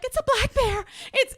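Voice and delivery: high, loud prank panic; mock alarm. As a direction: keep up the pretend emergency, urgent and high. Fake alarm continues.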